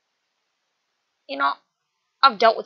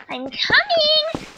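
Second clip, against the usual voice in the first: very high, drawn-out call; stretched-out, high-pitched holler; singsong call; 4 dB louder, much slower than usual. Acted. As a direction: call out slowly in a high squeal. Calls out while chasing.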